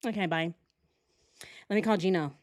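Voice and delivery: ordinary delivery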